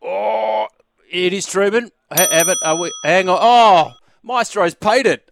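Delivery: high, loud delighted exclamation; thrilled quiz host crying out; excited surprise. Real, not acted. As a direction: burst out, thrilled by the call. Host surprised answer accepted.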